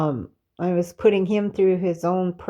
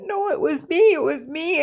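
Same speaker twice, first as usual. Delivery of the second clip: emotional retold protest, pitched much higher; arguing back; 9 semitones higher than usual. Woman retelling her argument with God, arguing back. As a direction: insist on it, voice leaping up. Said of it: "I was arguing with him".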